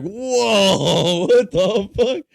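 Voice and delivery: loud, high laughing disbelief; shocked whoa. Retold or imitated. Waiting patient reacting to the yelling man, shocked laughing outburst. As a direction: blurt it out stunned and laughing. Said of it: "And I'm like".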